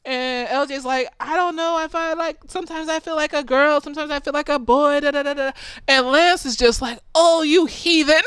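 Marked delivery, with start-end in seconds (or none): 1.2-5.5 put-on hesitant teen. A teen explaining to a strict father, unsure. 7.2-8.2 higher, hammed-up churchy dismay; mock pious outrage. Pious father scolding his child, mock outrage.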